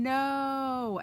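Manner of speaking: long high howled no; howling no. Acted. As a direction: howl it in denial.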